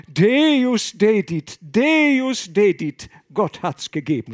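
loud, high, slow intoned Latin; kneeling abbot chanting thanks; solemn praise. Acted. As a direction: intone it like a hymn. Abbot praises God on knees.

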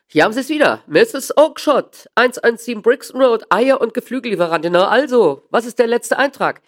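loud, high-pitched triumphant dealer. Acted. Dealer showing Holmes the ledger entry, loud and triumphant. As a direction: prove the customer wrong at full voice.

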